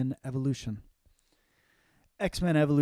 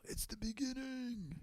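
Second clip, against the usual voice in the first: soft mock British delivery, lightly done; a hint of a hushed actress; imitating Carey Mulligan; 7 semitones higher, 10 dB quieter than usual. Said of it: "Carey Mulligan's using a real voice".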